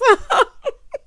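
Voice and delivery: loud, high cackle; real high-pitched laugh; short burst of laughter. Real, not acted. Cracks up at tight pants line.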